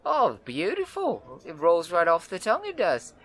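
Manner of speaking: very high, loud, bubbly woman's voice; excited red-haired woman. Acted. Wiry red-haired woman greets the adventurers, very high and thrilled. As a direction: bounce in thrilled to meet them. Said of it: "woman keeps greeting the party".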